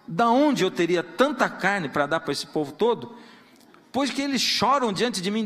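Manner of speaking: impassioned reading of Moses' complaint; scripture reading. Acted. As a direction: read Moses' lament with weight and force.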